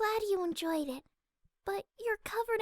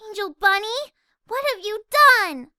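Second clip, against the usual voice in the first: much louder, scolding outburst; truly angry; 14 dB louder than usual. Acted. Fluttershy furiously scolding her bunny Angel. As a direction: let the anger burst out. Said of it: "She was truly angry".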